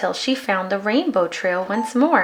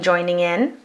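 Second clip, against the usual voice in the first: plain reading voice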